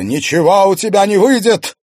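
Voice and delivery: very loud, high angry shout; fierce, ringing taunt; barked defiance. A narrator's reading. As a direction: shout down an enemy. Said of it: "рявкнула Клариса".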